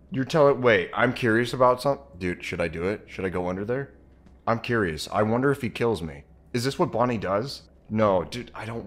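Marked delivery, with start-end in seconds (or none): none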